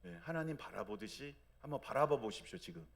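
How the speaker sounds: normal talking voice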